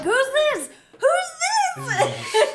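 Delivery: high, loud, laughing "who's this"; excited squeal. Retold or imitated. Player seeing a surprise caller, high laughing squeal. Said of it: "and I was like".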